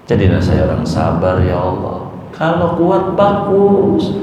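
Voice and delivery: normal talking voice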